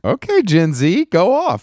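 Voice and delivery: high mock cheer, teasing the guest. Real, not acted. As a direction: egg them on in a playful high voice. Teasing guest's phone habit.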